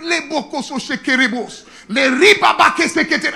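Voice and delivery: fervent loud burst of tongues; speaking in tongues. Retold or imitated. Pastor retelling praying in tongues at immigration, loud. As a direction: pour out tongues in a rush. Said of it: "I started speaking in tongues".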